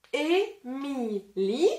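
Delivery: Émilie slowed right down, syllables pulled apart; slow syllable-split Émilie. Shown as a demonstration. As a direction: stretch Émilie into separate beats. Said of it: "je vais taper dans les mains".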